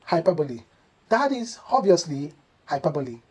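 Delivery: hyperbole as hy-PER-bo-lee; correct hyperbole. Shown as a demonstration. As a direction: end hyperbole on "lee", four syllables. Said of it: "you kindly use".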